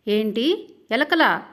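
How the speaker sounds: a sudden loud cry of alarm; shocked shout. Acted. Bride hearing about rats in the room, shouting in alarm. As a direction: yell it out, scared of rats. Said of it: "అని అరిచింది శివాని".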